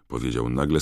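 ordinary delivery, nothing marked

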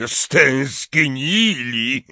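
very drawn-out, high mocking echo; gruff, derisive repeat; scornful snort. A narrator's reading. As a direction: stretch the word in disbelief. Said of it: "prychnął Hagrid".

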